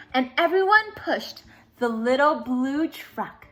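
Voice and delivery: usual speaking voice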